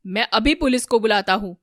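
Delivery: loud, forceful resolve; firm loud declaration. A narrator's reading. Ramesh threatens to call the police, loud and forceful. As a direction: announce it loudly and firmly.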